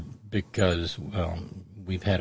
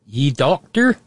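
put-on higher, louder eastern Kentucky hill accent; Appalachian accent; 6 semitones higher, 7 dB louder, slower than usual. Boarding house woman questioning the organizer, in Appalachian accent. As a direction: question sharply raised in a southern West Virginia accent. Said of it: "had that same accent".

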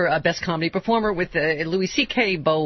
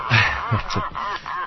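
laughing. Real, not acted. Laughing at own nominations.

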